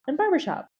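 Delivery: usual speaking voice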